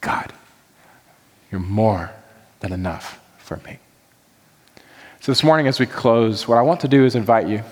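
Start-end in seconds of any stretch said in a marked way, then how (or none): 0.0-3.8 hushed, lower, intimate prayer; quiet confession. Acted. Believer confessing to God, hushed and low. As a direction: let it fall to a whispered confession. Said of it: "the confession".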